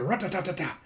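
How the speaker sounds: fast rat-ta-ta burst; imitated gunfire; mock machine-gun fire. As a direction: rattle it off quickly. Gunfire sound effect.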